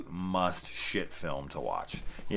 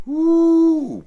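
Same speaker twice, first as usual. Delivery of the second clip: excited whoop; 15 dB louder than usual. Real, not acted. Gushing over Troll Two.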